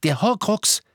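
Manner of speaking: high, agitated realisation; urgent exclamation. A narrator's reading. Hermine alerts Harry to the Horcrux, urgent.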